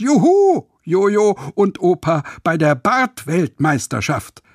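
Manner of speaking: viel höher und lauter jubeln; Jubelschrei. Acted. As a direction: vor Freude laut herausjubeln. Außer sich vor Freude. (Jojo jubelt laut über die Reise mit Opa)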